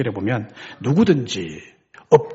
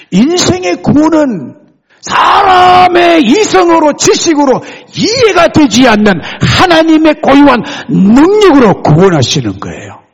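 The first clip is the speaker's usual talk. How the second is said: full-force preaching; 14 semitones higher, 10 dB louder than usual. Real, not acted. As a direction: thunder it out to the congregation.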